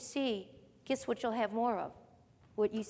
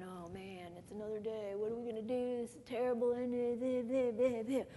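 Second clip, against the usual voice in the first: mock morning gloom; 6 dB quieter than usual. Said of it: "we wake up going".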